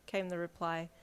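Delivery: plain reading voice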